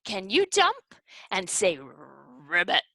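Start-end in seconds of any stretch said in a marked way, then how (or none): 1.8-2.8 low, rolled frog ribbit; frog croak. Shown as a demonstration. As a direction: demonstrate a frog's croak. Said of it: "Can you be like a frog?".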